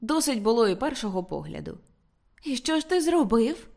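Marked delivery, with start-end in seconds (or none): 2.4-3.7 higher-pitched, mildly breath-held question; breathless suspense. A narrator's reading. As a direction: ask as if lightly holding your breath. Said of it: "затамувавши подих".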